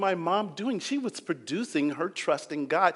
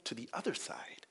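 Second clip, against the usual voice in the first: hushed, intimate whisper; whispered question; 5 semitones lower, 15 dB quieter than usual. Real, not acted. Preacher whispering to the congregation, hushed. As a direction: lean in and whisper the challenge.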